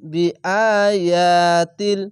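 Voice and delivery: loud, slow recitation of "বিআয়াতিল"; one-alif stretches. Shown as a demonstration. Teacher showing one-alif madd, "বিআয়াতিল" chanted slowly. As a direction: draw out both long vowels in "বিআয়াতিল". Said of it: "এক আলিফ টেনে পড়বেন".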